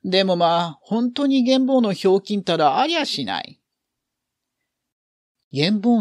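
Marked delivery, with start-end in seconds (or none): 0.0-3.6 高く張った声で面白がる母親の台詞; あきれ半分に声を高く大きく張って; 面白がる母親. A narrator's reading. 母親たちが面白がる台詞. (面白がる母親の甲高い声)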